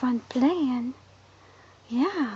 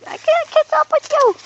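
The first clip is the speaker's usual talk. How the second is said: squeaky high voice; 14 semitones higher, 8 dB louder than usual. Pretend. Playing chase with dog.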